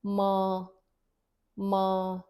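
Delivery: "ma" with a nasal vowel; "माँ" nasal. Shown as a demonstration. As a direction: let "ma" ring through the nose. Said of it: "there's a nasal touch to the word".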